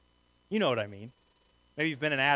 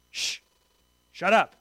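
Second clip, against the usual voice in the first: a shush, then a louder rebuke; scolding hush; 3 semitones higher, 5 dB louder than usual. Acted. Crowd turning on the blind beggar, scolding hush. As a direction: shush him, then shut him down firmly.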